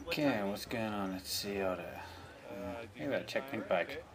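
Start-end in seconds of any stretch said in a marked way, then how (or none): none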